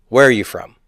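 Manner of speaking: "Where are you from" dropping at the end on "from"; falling question intonation. Shown as a demonstration. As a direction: ask it with "Where" leading and "from" falling. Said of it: "You don't have to go".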